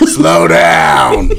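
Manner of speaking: put-on deep booming old man shout. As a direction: yell it like an old man on a porch.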